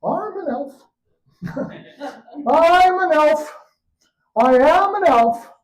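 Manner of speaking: put-on high loud frantic child insisting; desperate high shout. Boy facing the farmer's knife, high frantic insisting voice. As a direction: cry it out, slow and panicked.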